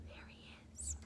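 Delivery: barely audible whisper. Real, not acted. Reader whispering to the kids about the Lorax.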